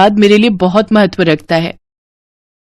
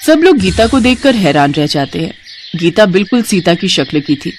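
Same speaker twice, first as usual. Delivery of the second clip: ordinary delivery, nothing marked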